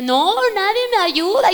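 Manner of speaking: a mock desperate daughter; whiny complaint. Retold complaint to father.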